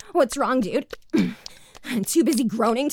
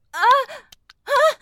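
high, loud startled moan; 10 semitones higher, 5 dB louder than usual. Acted. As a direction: cry out, caught off guard. Caught off guard, crying out.

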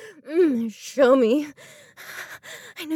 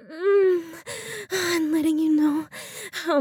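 moaning, rising much higher toward Dallas; building moans; 5 semitones higher than usual. Acted. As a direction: let the breath catch and climb higher. Building arousal in intimate scene.

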